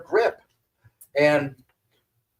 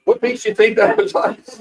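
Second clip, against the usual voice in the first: feigned innocence; 10 semitones higher, 6 dB louder than usual. Retold or imitated.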